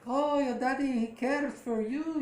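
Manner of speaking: put-on high, slow baby-talk to her belly; imitating a mother cooing to her bump; mock cooing mother.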